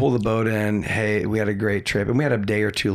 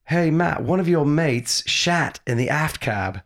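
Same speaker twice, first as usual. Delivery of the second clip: light British lady's voice, higher and slower; British accent; 5 semitones higher than usual. Retold or imitated. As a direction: mimic her prim British report, slow. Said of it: "she had a British accent".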